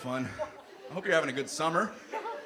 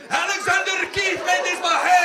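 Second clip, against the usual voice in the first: loud, high shouted Scottish pitch; hammed-up Scottish beer commercial; 13 semitones higher, 9 dB louder than usual. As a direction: bellow like a beer ad. Mocking beer ad in accent.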